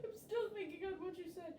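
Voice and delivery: high, fast, giggly words; amused, breaking into laughs; laughing. Real, not acted. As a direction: talk through leftover laughter. Still laughing at friend's earlier remark.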